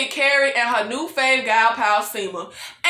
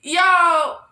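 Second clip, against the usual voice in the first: ordinary delivery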